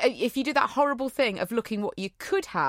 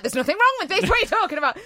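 indignant protest; 10 semitones higher, 6 dB louder, much faster than usual. Retold or imitated. Retold argument with boiler men.

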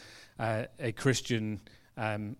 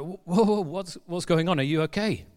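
startled and concerned, raised pitch and louder; alarmed whoa; 5 semitones higher, 3 dB louder than usual. Retold or imitated. Friends see Melody suddenly in tears, startled concern. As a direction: rush in, worried something is wrong. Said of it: "And they're like".

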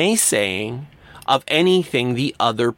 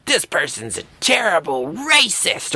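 higher, hammed-up denunciation; mock angry accusation; 6 semitones higher than usual. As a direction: swoop up into outraged name-calling. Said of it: "like, an angry and".

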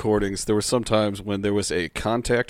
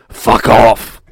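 much louder, rough demonic shout; 13 dB louder than usual. Retold or imitated. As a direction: spit it out as the possessing voice. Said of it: "favorite things to say from this voice".

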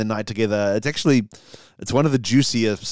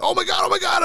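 much higher, frantic repeated cry; panicked. Acted. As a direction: panic at being discovered. Rob Schneider panicking.

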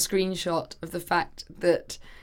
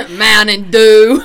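much louder, slow, booming announcement; war announcer; 16 dB louder, much slower than usual. Shown as a demonstration. Friend laughing through a second go at Mountain Dew, war announcer. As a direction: belt it out slowly like a grave announcer. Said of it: "You sound like you're announcing the war".

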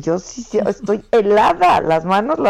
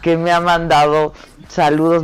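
usual speaking voice

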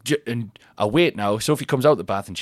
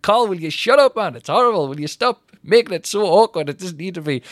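high, loud mock exasperation; exasperated telling-off; 7 semitones higher, 3 dB louder than usual. Partner telling Carl off for making it awkward, exasperated. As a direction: imitate a fed-up partner pleading for it to stop.

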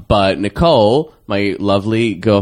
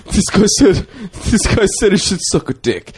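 laughing imitation; 12 semitones higher, 3 dB louder than usual. Voicing Kieran reading comment aloud.

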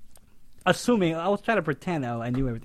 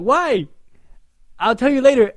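hurried call; 10 semitones higher, 13 dB louder than usual. Retold or imitated. Hurried exchange while fleeing.

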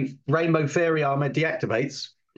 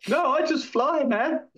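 character voice; 10 semitones higher than usual. Acted.

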